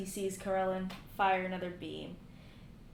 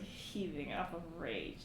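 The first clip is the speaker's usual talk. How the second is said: normal talking voice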